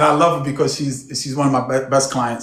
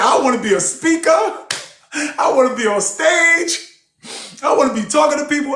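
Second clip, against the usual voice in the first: higher, eager, fast rush of wishes; excited client; 10 semitones higher than usual. Retold or imitated. Once-meek client telling her coach her dreams, excited. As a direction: gush like a newly bold woman. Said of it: "She said".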